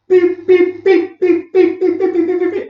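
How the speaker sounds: high, loud, rapid beeps like a checkout scanner; imitated scanner beep.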